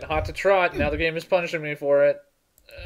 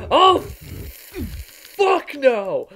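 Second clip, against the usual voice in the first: high startled cry; alarmed outburst; 8 semitones higher, 4 dB louder, much slower than usual. Real, not acted. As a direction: blurt it out in alarm. Sudden enemy, alarmed.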